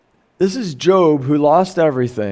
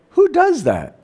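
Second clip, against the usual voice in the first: incredulous; 11 semitones higher than usual. Retold or imitated. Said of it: "He's like".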